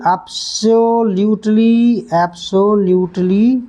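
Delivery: absolutely stretched very slowly; slow drilling. Shown as a demonstration. Teacher drilling absolutely very slowly for students. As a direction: drag out each absolutely for pupils. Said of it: "teacher repeats word slowly".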